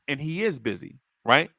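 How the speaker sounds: ordinary delivery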